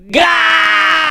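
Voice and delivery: high, long-held "gaaa" shout; long catchphrase yell. Pretend. Donation reader bellowing the show's catchphrase, long high yell. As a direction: belt the syllable out and hold it.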